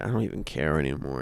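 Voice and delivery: ordinary talk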